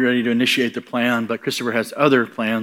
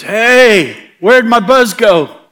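high, loud, slow bewilderment; newly sober man missing his buzz; puzzled ex-drunk; 10 semitones higher, 9 dB louder, much slower than usual. Retold or imitated. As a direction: call out, lost and baffled. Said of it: "he gets up and goes".

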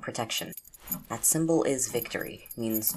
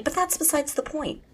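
a sudden high, louder brush-off; exasperated aside; 5 dB louder than usual. Acted. Friend chiding Micah, with a high exasperated brush-off. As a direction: wave the point away, pitched up. Teasing roommate about phone.

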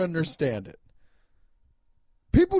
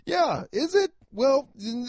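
mock disbelief; 9 semitones higher, slower than usual. Real, not acted. Sarcastic reply to cousin's text.

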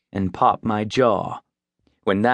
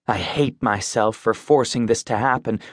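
ordinary delivery

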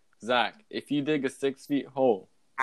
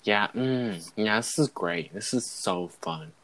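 low, murmured "mm, yeah" of a man enjoying himself; weird contented noises; 4 semitones lower, 4 dB quieter, slower than usual. Retold or imitated. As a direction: imitate a stranger moaning happily in the stall. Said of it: "he starts making weird noises like".

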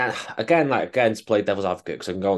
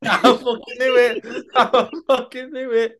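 much higher, slow, squealing with laughter; laughing hard; 5 dB louder, slower than usual. Real, not acted. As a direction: crack up through the words. Punchline lands, laughing hard.

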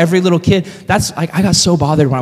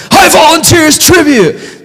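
high, hammed-up desperate shout; bad Katniss voice; 13 semitones higher, 4 dB louder than usual. Katniss freaking out to save her little sister, high mock-desperate shout. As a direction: cry it out in mock panic, pitched way up. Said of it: "that's not a good Katniss voice".

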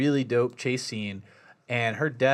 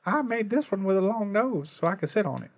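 character voice; 8 semitones higher than usual. Retold or imitated. Quoting a toy character, far voice.

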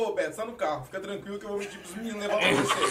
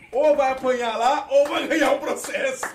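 mock warning; 5 semitones higher, 7 dB louder, slower than usual. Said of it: "ele falou".

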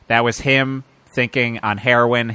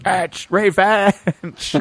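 mock yell; 6 semitones higher, much slower than usual. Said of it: "is to just yell out".

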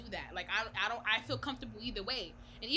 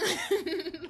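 high burst of laughter; 9 semitones higher, 7 dB louder than usual. Real, not acted. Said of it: "Bitch, I'm about to slice your throat".

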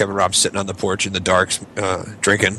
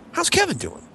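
much higher, squeaky surprised question; mock disbelief. Host amazed at who his guest drank with, squeaky surprise. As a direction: jump way up on it, comically amazed.

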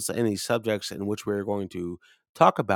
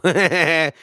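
loud laugh; 7 semitones higher, 9 dB louder than usual. Real, not acted. Laughs at own story.

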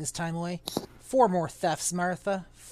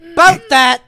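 much louder, higher comic yell; joking shout; 11 semitones higher, 19 dB louder than usual. Real, not acted. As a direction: shout it out for a laugh. Readers burst out joking.

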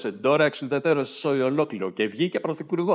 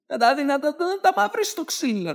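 mock old lady rant; 12 semitones higher than usual. Mock angry old woman ranting.

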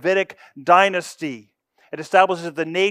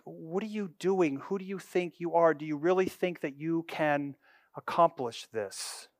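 mocking; 8 dB quieter than usual. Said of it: "this mocking laughter".

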